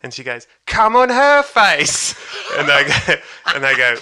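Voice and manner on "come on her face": slow, high shout from the back; heckler's shout. Retold or imitated. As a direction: drag out a loud demand to the stage.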